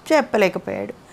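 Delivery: plain reading voice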